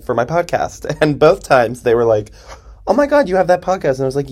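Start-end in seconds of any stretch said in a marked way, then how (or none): 2.9-4.0 higher-pitched starstruck fan imitation; excited fan. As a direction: play a fan thrilled to recognize someone. Fans recognizing her, acted.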